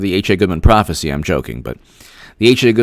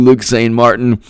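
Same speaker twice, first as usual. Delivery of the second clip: usual speaking voice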